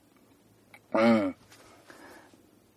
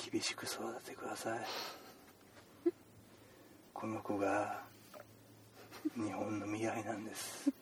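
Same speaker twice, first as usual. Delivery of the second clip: earnest as if the nation depends on it; doctor gravely addressing the mother; mock solemn; 3 semitones lower, 17 dB quieter than usual.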